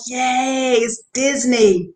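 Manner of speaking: high excited cheer; a touch of giddy excitement; stoked. Retold or imitated. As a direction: cheer like a happy kid at Disney. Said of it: "I'm, like, stoked".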